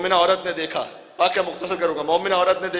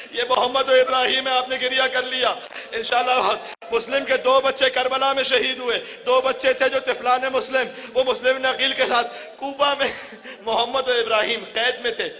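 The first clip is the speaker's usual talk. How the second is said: full-force mourning sermon, voice pitched up; impassioned preaching; 6 semitones higher than usual. Acted. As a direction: preach it with rising grief. Masaib recited at full force.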